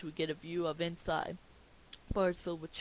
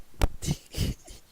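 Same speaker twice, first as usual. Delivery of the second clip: loud breathless wheeze of laughter; real laughing fit; 9 dB louder than usual. Real, not acted. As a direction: laugh helplessly. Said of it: "why I'm laughing".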